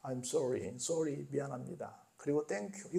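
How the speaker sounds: 외국에서 습관처럼 부드럽게 하는 인사; polite traveler. Retold or imitated. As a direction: murmur them like habitual courtesies. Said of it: "이걸 막 입에 달고 살아요".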